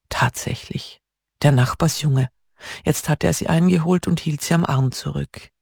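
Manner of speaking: usual speaking voice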